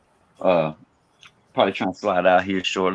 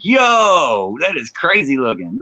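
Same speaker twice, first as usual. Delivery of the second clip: excited shout; 5 semitones higher, much slower than usual. Retold or imitated. Retelling amazed reaction to makeup.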